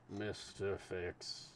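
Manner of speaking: lightly voiced slow, steady official; unhurried, measured consul.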